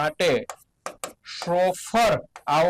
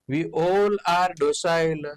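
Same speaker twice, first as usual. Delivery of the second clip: slow example; much slower than usual. Shown as a demonstration. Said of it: "teacher demonstrates usage slowly".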